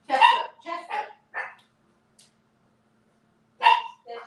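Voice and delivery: high, loud call of the name; high-pitched, loud calls to a barking puppy; calling the dog. Real, not acted. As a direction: call him off sharply.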